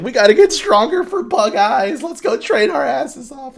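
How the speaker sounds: high, tearful mock shout; comic loudmouth wailing a rallying cry; Inosuke. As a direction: holler through pretend sobs. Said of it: "this really loudmouth fucking dude".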